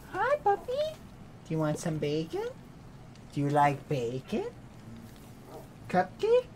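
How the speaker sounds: squeaky, gentle doggy talk; pet talk. Real, not acted. Talking to the dog.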